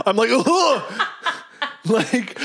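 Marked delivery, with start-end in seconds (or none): 0.3-0.8 high, mock-horrified "ugh"; dread-filled groan. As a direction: groan in playful dread, pitched up. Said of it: "fills me with dread".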